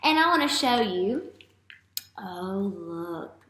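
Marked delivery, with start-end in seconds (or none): none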